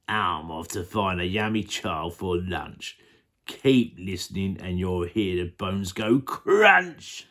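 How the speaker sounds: very low, slow crocodile growl; menacing crocodile. Acted. Crocodile threatening the elephant, very low and slow. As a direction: draw it out deep, rising on the last word. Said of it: "The enormous crocodile grinned".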